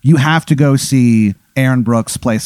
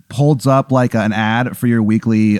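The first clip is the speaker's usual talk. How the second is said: normal talking voice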